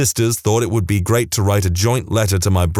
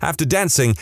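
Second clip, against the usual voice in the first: ordinary delivery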